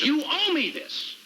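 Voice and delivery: slow, high-pitched demand; heated, drawn-out insistence; insistent demand. Acted. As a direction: lean hard on every word, pitch up. Demands a favour from boss.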